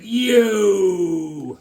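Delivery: long, loud, high drawn-out cry; mock holler. Voicing a guy he drew, mock holler.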